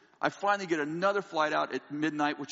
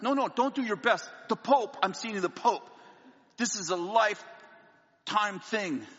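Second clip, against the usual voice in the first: higher pleading, slowing at the end; insistent pleading; 6 semitones higher than usual. Retold or imitated. Pleading on the phone for the suit, insistent. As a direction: beg them not to just try. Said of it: "we will do our best".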